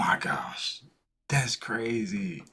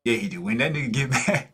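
ordinary delivery

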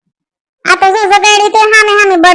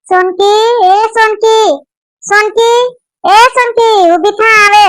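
raised, higher call to wake someone; 5 semitones higher, slower than usual. Acted. Mother-in-law calling Sonki out of bed, raised and higher.

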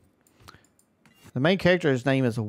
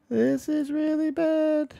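high, slow whine of dismay; slower than usual. Real, not acted. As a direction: wail that it's going wrong. New portal overwhelms him.

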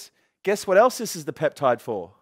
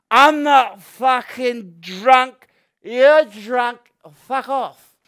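put-on loud, higher, slurred drunk denial; belligerent drunk; 7 semitones higher, 8 dB louder, much slower than usual. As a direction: play a shouty drunk refusing to go home.

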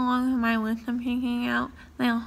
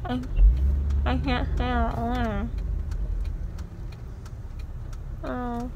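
talking around gauze pads; muffled mouth stuffed speech; mouth full of gauze; slower than usual. Real, not acted.

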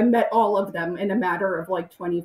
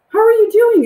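high, loud bright hello; Rodney greeting a fan, over-bright; mock eager greeting; 11 dB louder than usual. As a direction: greet her with exaggerated cheer. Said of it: "he was like".

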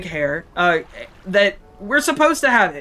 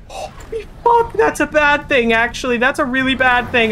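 high squeal dropping into tense alarm; startled, high-pitched alarm; 5 semitones higher than usual. Real, not acted. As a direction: react in panic, pitched up. Sudden danger in the game.

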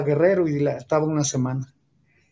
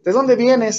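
sharp, high, loud father's question; mock-stern father, louder and higher; stern demand; 7 semitones higher, 6 dB louder, slower than usual. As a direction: snap the question at the kid. Father questions son's whereabouts.

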